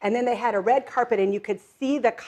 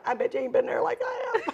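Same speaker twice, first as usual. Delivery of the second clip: high, cheeky bragging voice; sassy boast; 3 dB quieter than usual. Retold or imitated. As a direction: brag it with a sassy grin. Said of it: "I'm like".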